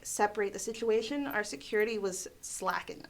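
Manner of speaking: ordinary delivery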